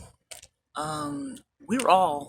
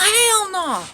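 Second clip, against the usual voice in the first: mock guard retort; 13 semitones higher, 7 dB louder than usual. Guard refusing.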